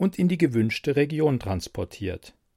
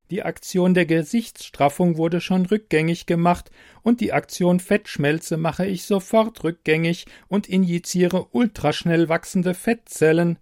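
lightly voiced smart computer, a touch higher; a helpful machine, evenly spoken; 7 semitones higher, 4 dB louder than usual. Computer voice in story.

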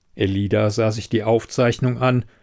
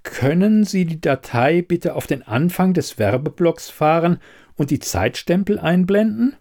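higher-pitched, businesslike character voice; requesting investigator; 7 semitones higher than usual. Acted. Elida asking the quizmaster to rewind, higher businesslike voice. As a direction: make a firm polite request in a higher voice.

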